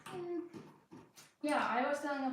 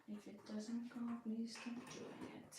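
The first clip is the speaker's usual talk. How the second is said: soft self-plea; 2 semitones higher, 9 dB quieter than usual. Real, not acted. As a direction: beg yourself softly, leaning on "please". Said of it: "I keep literally telling myself".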